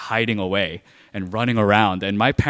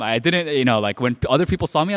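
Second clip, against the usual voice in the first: ordinary talk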